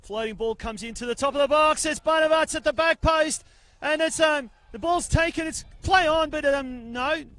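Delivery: high, loud goalmouth call; excited play-by-play. Real, not acted. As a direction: shout the scramble as it happens. Goalmouth scramble live commentary.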